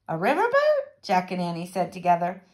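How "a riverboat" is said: high, rising echo; surprised question. A narrator's reading. Jack and Annie hearing of the gig, high surprise. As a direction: repeat it in astonishment.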